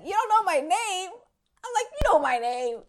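high-pitched incredulous retort; acted disbelief. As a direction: replay astonishment, pitched right up. Acts out her disbelief at friend.

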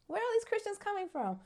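high, soft, laughing wonder; comic bewilderment. Retold or imitated. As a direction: squeak it out in amused disbelief.

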